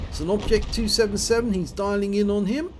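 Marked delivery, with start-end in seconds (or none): none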